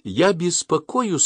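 a touch of tears, slow; tearful, slow. A narrator's reading. Mother worried about her son, slow and tearful. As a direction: hold back tears. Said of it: "со слезами на глазах сказала мама".

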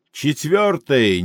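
usual speaking voice

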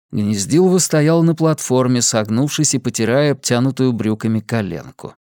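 plain reading voice

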